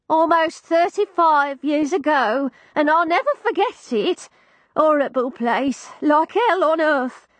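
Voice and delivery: put-on a deaf old lady, bewildered; old landlady voice.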